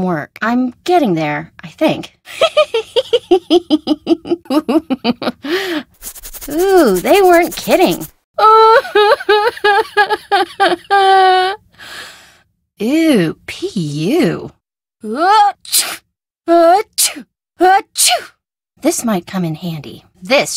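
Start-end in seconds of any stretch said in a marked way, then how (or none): 2.3-5.8 laugh. Acted. Laughing at something. 8.4-11.6 laugh. Acted. Reaction to awful smell. 15.0-18.3 sneezing. Acted. Sneezing from spice.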